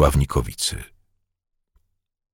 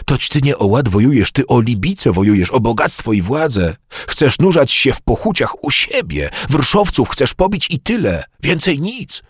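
higher-pitched, climbing reproach; heated accusation; 8 semitones higher than usual. Acted. Gaudenty accusing his brother of fighting for wealth, heated.

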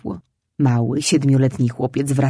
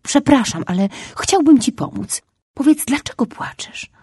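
put-on eager boy's plea; 9 semitones higher than usual. A narrator's reading. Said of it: "więc wyjaśnił".

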